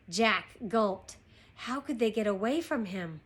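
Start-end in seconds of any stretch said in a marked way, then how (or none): none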